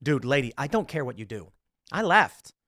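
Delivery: ordinary talk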